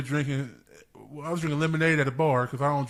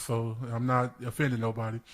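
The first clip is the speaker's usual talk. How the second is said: ordinary talk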